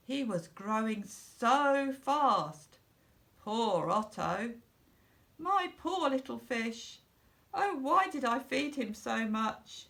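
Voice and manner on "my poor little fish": ordinary delivery, nothing marked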